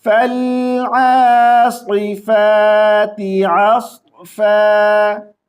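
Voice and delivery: slow melodic verse; slowly recited verse for the class; tajweed recitation. Shown as a demonstration. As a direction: recite it drawn-out. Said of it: "अब सुने".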